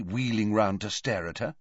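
ordinary delivery, nothing marked

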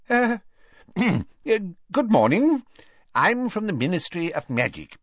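high-pitched, hesitant official greeting; nervous. A narrator's reading. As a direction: clear the throat and greet uneasily. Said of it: "said Ogden nervously".

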